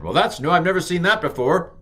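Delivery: mock amazement at a dot; mock surprise. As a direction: pretend you've never seen it.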